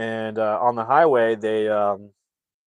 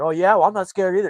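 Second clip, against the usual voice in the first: fast, higher, louder one-upping; competitive bravado; 9 semitones higher, 7 dB louder, faster than usual. Retold or imitated. Cop answers rival cop, fast high bravado. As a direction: toss it back quickly to outdo him. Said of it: "He's like".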